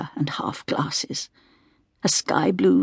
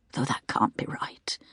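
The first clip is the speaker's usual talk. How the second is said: whispered doubt; 6 semitones lower, 10 dB quieter than usual. A narrator's reading. Narrator's private doubt.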